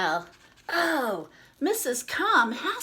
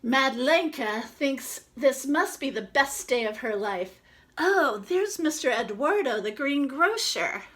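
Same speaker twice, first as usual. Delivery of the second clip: lively storybook read-aloud; reading to children; 5 semitones higher, 7 dB louder than usual. Acted. Reads picture book to children.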